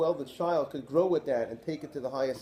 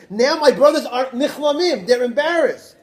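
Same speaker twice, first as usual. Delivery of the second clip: animated exclamation; 7 semitones higher, 11 dB louder than usual. Retold or imitated. Yosef sees brothers ashamed, voiced.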